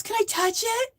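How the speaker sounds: a sugary mock request to touch; imitating the stranger. Bank lady asking to touch her hair, high and soft.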